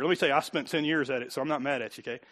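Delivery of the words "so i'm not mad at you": ordinary talk, nothing marked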